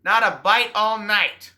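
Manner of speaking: loud, high shout from the boat; calling back. Acted. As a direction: yell back across the lake.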